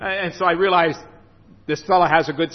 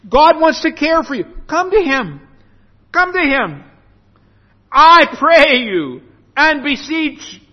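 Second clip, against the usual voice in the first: high, raised preacher's appeal; urgent preaching; 7 semitones higher, 4 dB louder than usual. Real, not acted. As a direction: plead from the pulpit in a lifted voice. Preacher urges listeners to come.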